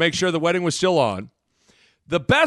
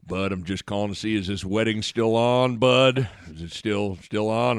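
Coach Altman; 8 semitones lower than usual. Retold or imitated. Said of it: "do the impression".

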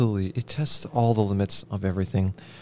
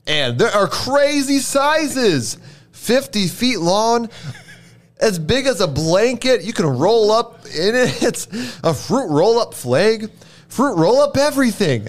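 excited; 9 semitones higher, 4 dB louder than usual. Acted. Excited pitch for giant fruit roll-ups.

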